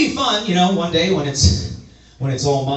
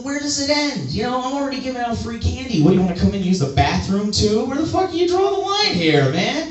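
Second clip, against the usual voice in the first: mock rant; 8 semitones higher than usual. Ranting at teen asking glass.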